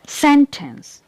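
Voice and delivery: "Sentence" as SEN-tence, high then falling; stress on first syllable. Shown as a demonstration. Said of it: "I am giving a stress on the first syllable".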